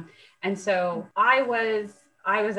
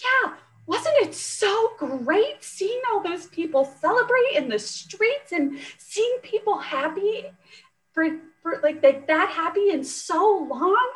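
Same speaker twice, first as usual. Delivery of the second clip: put-on much higher, sweet old lady voice; little old lady; 10 semitones higher than usual. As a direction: imitate the gushing church lady. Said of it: "She goes".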